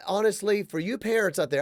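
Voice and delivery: normal talking voice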